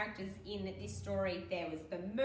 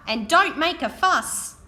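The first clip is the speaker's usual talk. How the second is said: called out; 8 semitones higher, 18 dB louder, slower than usual. Acted. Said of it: "called the driver".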